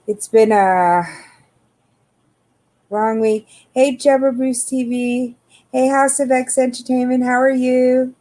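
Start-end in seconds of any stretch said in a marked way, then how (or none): none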